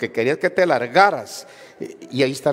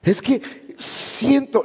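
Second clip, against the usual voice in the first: mock whiny; 8 semitones higher, much slower than usual. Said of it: "Nunca entendió que era eso".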